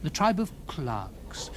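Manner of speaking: ordinary delivery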